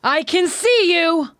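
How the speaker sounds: high, drawn-out battle cry; acted high-pitched yell, slow; scream. A narrator's reading. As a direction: scream it out at the enemy. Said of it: "screams out to Ymir's Titan".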